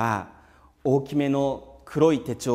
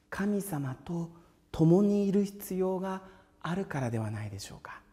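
whisper; 5 semitones higher, 5 dB quieter than usual. Real, not acted. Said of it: "drops to a whisper".

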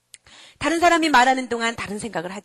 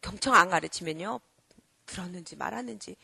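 normal talking voice

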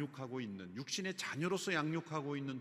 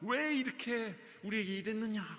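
higher, scolding rebuke from a relieved mother; 6 semitones higher than usual. Shown as a demonstration. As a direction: reproach the lost son sharply. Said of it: "나무라는 거죠". (꾸짖는 어머니의 높은 목소리)

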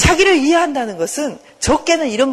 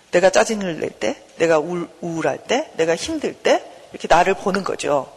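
ordinary delivery

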